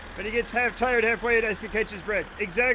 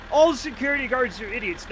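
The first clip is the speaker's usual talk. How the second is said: normal talking voice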